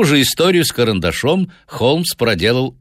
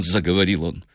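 usual speaking voice

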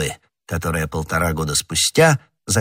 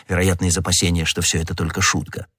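plain reading voice

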